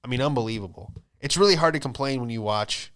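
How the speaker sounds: usual speaking voice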